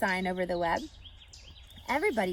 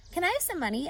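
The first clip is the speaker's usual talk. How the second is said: put-on eager girl; 9 semitones higher, faster than usual. A narrator's reading. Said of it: "asked Fern".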